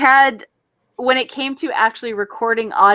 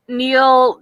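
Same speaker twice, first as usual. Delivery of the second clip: ordinary talk, nothing marked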